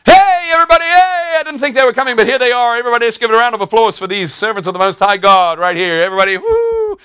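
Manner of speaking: put-on very high, loud shrill girl crying out; high, loud mocking girl voice; shrieking slave girl. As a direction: squeal it out like the pestering girl. Preacher voicing the slave girl.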